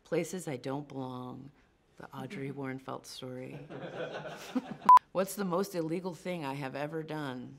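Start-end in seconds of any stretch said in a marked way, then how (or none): 3.6-5.1 bright burst of laughter. Real, not acted. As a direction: laugh out loud. Laughing at own answer.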